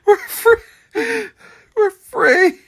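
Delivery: high wail, laughing through tears; full sobbing. Acted. Widow sobs at the grave. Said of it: "Sobbing more fully".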